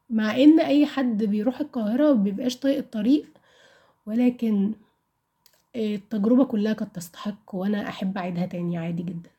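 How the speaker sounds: normal talking voice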